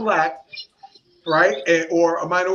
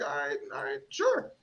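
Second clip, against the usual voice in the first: mock grudging concession; 10 semitones higher, 9 dB quieter than usual.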